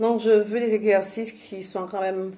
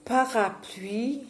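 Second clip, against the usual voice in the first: drawn-out word; 4 semitones higher, 4 dB quieter, much slower than usual. Shown as a demonstration. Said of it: "Je dis".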